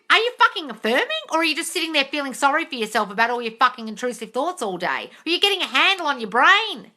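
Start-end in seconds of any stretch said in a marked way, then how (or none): none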